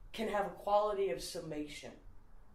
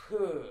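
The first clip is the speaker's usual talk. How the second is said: louder breathy puff for "who"; barely-formed who; 6 dB louder than usual. Retold or imitated. Repeating the coffee shop reply for laughs, breathy who. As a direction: push "who" out as a wordless whoosh. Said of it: "He doesn't even say who".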